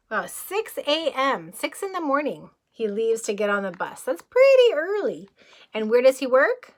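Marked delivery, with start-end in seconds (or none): none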